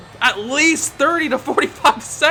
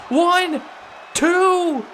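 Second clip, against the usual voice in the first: each count higher, up to a shout on three; building count; 7 semitones higher, much slower than usual. Acted. Commentator counting the title-winning pin, building to a shout.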